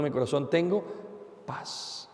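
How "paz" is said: whispered. Acted. Whispered climax on peace.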